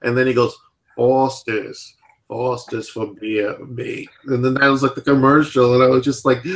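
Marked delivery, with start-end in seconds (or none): 1.0-4.1 slow mock Australian ad tagline, trailing softer; bad Australian accent. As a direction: imitate an Aussie beer pitchman. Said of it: "I don't do a good Australian accent".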